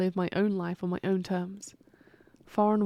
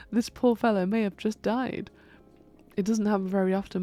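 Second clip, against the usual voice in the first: normal talking voice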